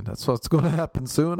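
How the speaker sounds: usual speaking voice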